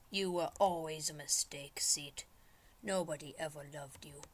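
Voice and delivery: low, hushed put-down; mock menace. Player disowns a seat in the game, hushed mock spite. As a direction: mutter it cruelly under the breath.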